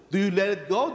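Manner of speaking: ordinary talk, nothing marked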